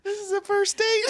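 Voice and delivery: high, loud, cracking up through the words; laughing disbelief. Real, not acted. Host laughing at a Golden Corral first date, cracking up. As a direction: cackle out the line in disbelief.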